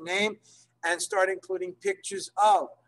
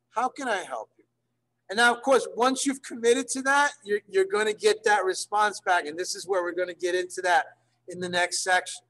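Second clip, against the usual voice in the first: normal talking voice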